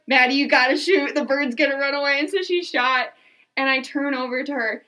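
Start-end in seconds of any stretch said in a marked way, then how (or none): 0.1-2.2 loud, urgent retold plea; imitating a pressing, raised-voice prompt; urgent coaching. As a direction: push her to act before it's too late. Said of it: "Third one".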